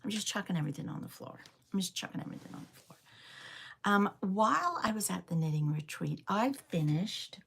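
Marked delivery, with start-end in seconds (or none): 1.7-3.0 breathy whisper, quick and much softer; whispered repeat. Real, not acted. Tidying up on stream, she whispers the line to herself again. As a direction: mutter it again under the breath, fast.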